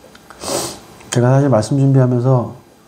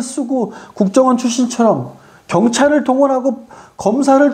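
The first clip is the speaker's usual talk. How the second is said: high-pitched, driving sermon delivery; impassioned preaching; 12 semitones higher than usual. Real, not acted. Preacher denouncing those who flaunt power, impassioned. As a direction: preach it high and insistent, piling up the list.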